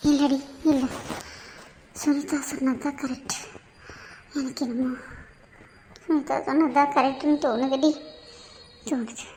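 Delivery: soft, broken tearful voice, fading to almost nothing. Acted. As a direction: let the words dissolve into tears. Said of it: "அழுகிறது நிறுத்து". (அழுகையில் உடைந்த மெல்லிய குரல்)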